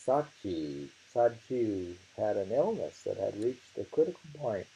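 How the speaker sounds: usual speaking voice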